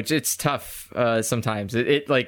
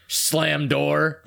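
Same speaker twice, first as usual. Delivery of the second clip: deadpan sound effect; 2 semitones higher than usual. Pretend. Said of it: "did Piccolo just say he slammed the door".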